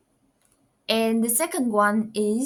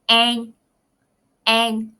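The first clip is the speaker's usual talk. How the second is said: "An," in Southern Vietnamese, ending like ng; Southern dialect; 6 dB louder than usual. Shown as a demonstration. Teacher demonstrating Southern Vietnamese dialect. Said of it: "only in the Southern dialect".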